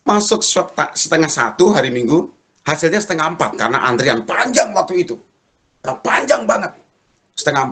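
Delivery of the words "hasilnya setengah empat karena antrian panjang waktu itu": usual speaking voice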